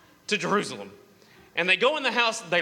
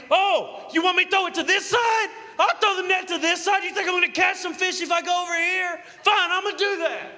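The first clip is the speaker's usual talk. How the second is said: high, fast, exasperated acting; hammed-up frustrated protest, pitched way up; indignant mock outburst; 10 semitones higher than usual. Peter griping back at advice from shore, exasperated mock outburst.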